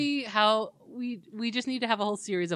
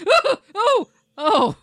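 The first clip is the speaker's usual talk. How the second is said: mock shock; 9 semitones higher, 11 dB louder, slower than usual.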